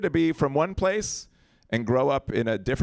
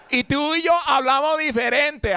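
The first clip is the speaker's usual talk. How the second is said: high, animated Puerto Rican Spanish; Puerto Rican accent; 11 semitones higher, 4 dB louder than usual. Retold or imitated. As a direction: protest as the Puerto Rican kid. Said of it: "And I'm like".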